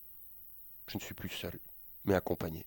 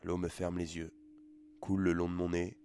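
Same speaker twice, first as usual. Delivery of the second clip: ordinary delivery, nothing marked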